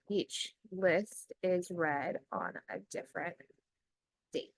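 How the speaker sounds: slow, word by word, as if typing along; measured dictating voice with long pauses; slow dictation. Acted. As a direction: spell out each phrase for the computer. Dictating next prompt.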